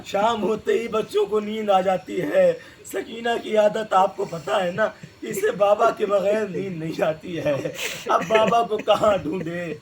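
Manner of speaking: ordinary talk, nothing marked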